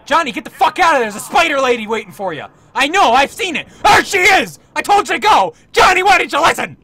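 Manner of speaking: loud, high mock-panicked shouting; frantic warning yell. Player warns Johnny about a spider lady, frantic yelling. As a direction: yell a hammed-up warning across the room.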